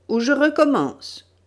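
loud, high threatening cry; shouted threat. Acted. Gunman threatens to fire on the group, shouting. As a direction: shout it as a warning shot. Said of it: "cria le tireur".

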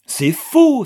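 loud, high-pitched flat denial; forceful outcry. A narrator's reading. Anna defends her accused fiancé, crying out forcefully. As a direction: cry it out with full energy. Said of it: "s'écria Anna, avec une énergie".

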